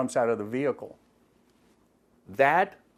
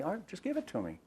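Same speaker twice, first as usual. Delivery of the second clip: softer, higher, easy-going ask; calm request; 8 semitones higher, 9 dB quieter, faster than usual. Retold or imitated. Officer asking driver for his license, mild calm voice. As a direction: calmly coax him to hand it over. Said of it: "Chris very calmly say".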